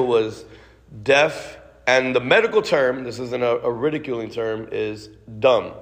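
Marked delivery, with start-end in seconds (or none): none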